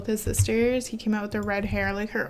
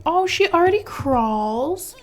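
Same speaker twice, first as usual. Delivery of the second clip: high, loud and slowed with delight; 7 semitones higher, 6 dB louder than usual. Real, not acted. Gamer cooing at the baby sim, delighted.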